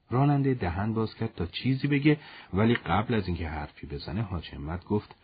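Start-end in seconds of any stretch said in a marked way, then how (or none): none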